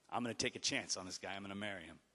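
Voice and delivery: low, soft, private vow; hushed resolve. Retold or imitated. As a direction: say it softly, almost to yourself. Ruth's private decision, voiced in story.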